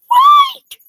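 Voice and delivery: very high, loud shriek of right; a piercing demand for agreement; shrieked question. Real, not acted. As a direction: screech it at them.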